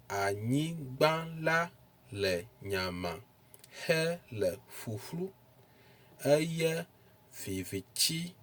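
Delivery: teacher reading scripture with clear tones; tonal Ewe reading. Shown as a demonstration. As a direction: read the Ewe verse aloud, marking each syllable's tone. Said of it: "let's read Genesis chapter one".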